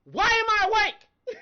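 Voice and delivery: fed up with God over lost sleep, wailing; mock anguished wail. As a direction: wail it to heaven, half laughing. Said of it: "I was mad this morning".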